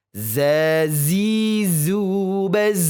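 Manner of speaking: "Zazizubaz" stretched out slowly and loud; slow zai drill. Shown as a demonstration. Teacher drills zai for the class, slow and buzzing. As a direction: buzz "Zazizubaz" long and slow. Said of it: "Huruf zai".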